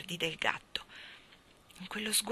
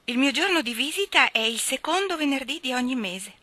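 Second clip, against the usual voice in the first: higher, lilting queen's voice; gracious queen; 6 semitones higher, 5 dB louder than usual. Acted. Queen telling the cat her visiting day, gracious. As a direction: lift the pitch for a regal invitation.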